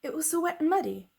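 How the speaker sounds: a lightly voiced small girl explaining; little girl's answer. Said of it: "Sarah answered".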